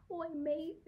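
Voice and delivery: high-pitched mock British accent; joking British accent. Retelling the British student's joke, mock British accent. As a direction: imitate a jokey British greeting. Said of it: "he kinda joked and be like".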